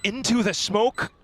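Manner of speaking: excited. Real, not acted. Caster calls the backstab kill.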